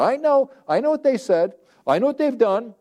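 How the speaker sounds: high-pitched, insistent mock protest; exasperated protest. Acting out an exasperated protest to God.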